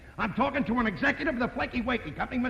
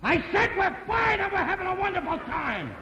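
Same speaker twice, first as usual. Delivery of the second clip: high, loud shout; shouting down the phone; 10 semitones higher than usual. Acted. Ralph yells into the bad phone line to Mister Lewis. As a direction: bellow it so the caller finally hears. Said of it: "you must speak a little louder".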